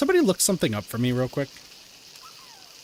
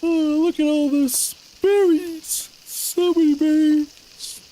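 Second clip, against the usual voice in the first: much higher, louder put-on squeal of delight; excited cute voice; 13 semitones higher, 6 dB louder than usual. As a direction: gush over the berries in a tiny cartoon voice. Gushing over berries in game.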